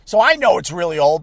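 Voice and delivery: ordinary talk, nothing marked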